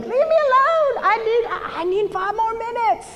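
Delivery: exaggerated frazzled yelling; mock yell. Wife retelling yelling at her husband before church, hammed-up. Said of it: "I just yelled at my husband".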